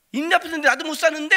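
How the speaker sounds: quick, high, loud scoff; fast mocking jeer. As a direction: rattle off the taunt. Friends mocking.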